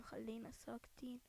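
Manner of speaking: soft unvoiced whisper of ساكتين. Real, not acted. Telling teammates to stay quiet while sneaking, whispered. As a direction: drop to a breath.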